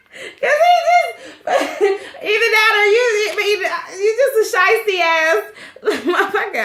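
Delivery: high, laughing insult; laughing hard. Real, not acted. Viewer laughing while calling the husband shiesty, high pitched. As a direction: crack up mid sentence.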